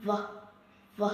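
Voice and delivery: V as a buzzing vvv; phonics sound V. Shown as a demonstration. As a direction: hold the lip buzz vvv. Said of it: "We pronounce it V".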